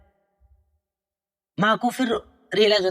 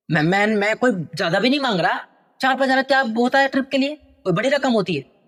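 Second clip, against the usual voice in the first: angry son; 4 semitones higher than usual. Acted. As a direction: rant like a spoiled son. Son keeps yelling at mother. (गुस्से से भड़कता बेटा)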